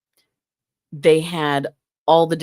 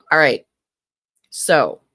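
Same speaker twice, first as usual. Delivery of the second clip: ordinary talk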